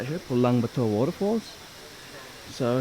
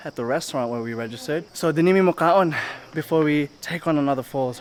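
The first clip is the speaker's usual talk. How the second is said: ordinary talk, nothing marked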